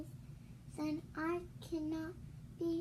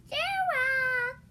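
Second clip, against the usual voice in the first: high loud call; excited called-out name; 10 semitones higher, 9 dB louder than usual. Acted. Child calling a character.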